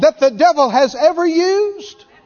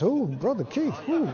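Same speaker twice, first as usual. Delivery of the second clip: much softer, lower, squeamish; mock cringing charismatic; 4 semitones lower, 12 dB quieter than usual. Preacher mocking squeamish charismatics, soft prim cringing. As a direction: wince out a prim little ooh. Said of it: "charismatics cringe about stuff like this".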